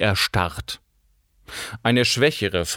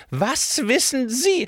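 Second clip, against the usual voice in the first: accusing diva; slower than usual. A narrator's reading. Cora accusing the baroness.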